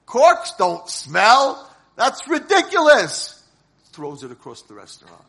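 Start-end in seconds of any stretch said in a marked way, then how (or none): 0.1-3.4 put-on drunken scoff. Drunk man scolds the waiter.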